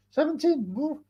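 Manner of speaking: high, squeaky fake shock; mock astonishment. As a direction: act stunned in a high voice.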